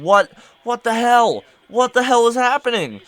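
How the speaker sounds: high, emphatic disbelief; exasperated bafflement. Real, not acted. Ranting about the tickler, exasperated.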